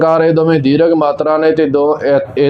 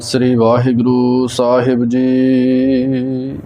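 measured Gurbani recitation on a level tone; even, unhurried devotional reading; scripture recitation; 2 semitones lower, slower than usual. Real, not acted. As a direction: recite line by line with drawn-out endings.

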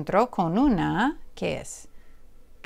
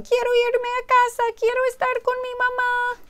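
high, quick pleading chick; high tearful bird voice; pleading little bird. Acted. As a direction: beg to go home. Bird wants to go home.

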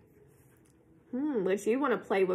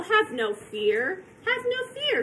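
breezy cat; 8 semitones higher than usual. Acted. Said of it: "said the cat".

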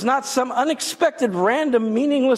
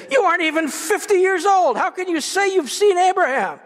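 scornful disbelief; 7 semitones higher than usual. Acted. People mocking Jesus' age.

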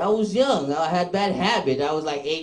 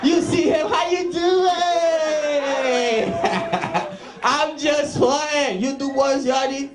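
mock jail shout; 7 semitones higher than usual.

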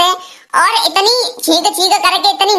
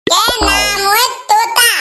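drawn-out high boast; 4 semitones higher, much slower than usual. Acted.

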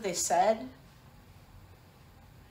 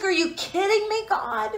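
relieved disbelief; 9 semitones higher than usual. Retold or imitated. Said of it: "It's just sheer relief".

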